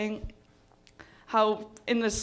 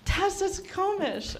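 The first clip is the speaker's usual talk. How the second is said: high, slow mock-German delivery; imitated German friend; 10 semitones higher, slower than usual. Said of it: "But he's just like".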